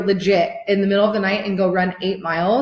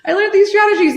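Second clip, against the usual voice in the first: much higher, squealing gush; gushing excitement; 14 semitones higher, 6 dB louder than usual. Retold or imitated. Client telling a student about her coach, high squealing gush. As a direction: gush like a thrilled fan. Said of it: "she's like".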